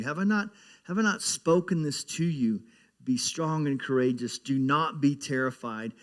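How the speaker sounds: preaching scripture. Acted. Pastor to congregation, reading Joshua with mounting force. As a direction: proclaim the verse with growing force.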